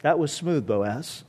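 low, soft, knowing approval; relieved admiration. Acted. Preacher as romantics admiring Boaz's backup plan, quietly impressed. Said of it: "And now we're like".

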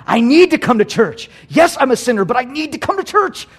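loud, high-pitched insistence; defiant declaration. Acted. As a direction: push back forcefully at the accuser. Acted defiant answer to Satan.

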